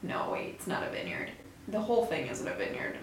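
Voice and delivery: hushed self-correction; muttering. Real, not acted. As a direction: mutter it to yourself. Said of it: "self-correction muttered".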